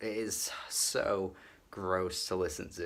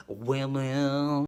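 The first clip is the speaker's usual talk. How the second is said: "Women." belted louder as a cheesy anthem; mock proclamation; 4 semitones higher, 6 dB louder than usual. Reviewer parodying the cheesy chorus, loud hammed-up proclamation. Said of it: "mocking the song's lyrics".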